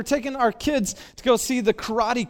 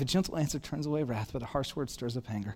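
soft scripture quote; 8 semitones lower, 11 dB quieter, faster than usual. Shown as a demonstration. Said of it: "repeats verse softer".

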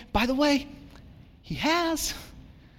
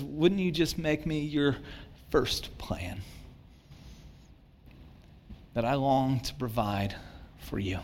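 lightly voiced God, softer and lower; gentle God voice; 7 semitones lower, 6 dB quieter than usual. Pastor voicing God, softly inviting his people. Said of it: "He says".

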